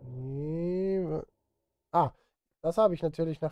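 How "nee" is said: ordinary talk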